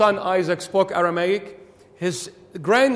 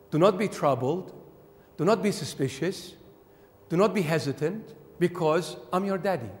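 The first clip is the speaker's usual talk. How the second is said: calm the listener gently, pausing between phrases; reassuring; 2 semitones lower, 5 dB quieter than usual. Acted. Jesus telling his children not to fear, reassuring.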